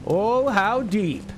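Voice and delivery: slow, high, drawn-out mock wonder; hammed-up sarcastic amazement; mock awe. Mock awe at plot twist.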